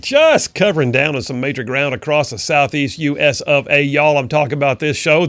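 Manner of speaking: loud, punchy show-return announcement; radio host intro. Acted. Host welcoming radio listeners back after the break, booming announcer voice.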